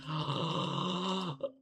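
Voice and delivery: long, high gasp of suspense; mock gasp. Player awaiting yet another repeated line, long mock gasp. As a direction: draw in a big hammed-up gasp.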